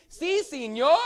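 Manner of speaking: si, senor called high and loud in a Spanish accent. Shown as a demonstration. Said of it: "good Spanish accent".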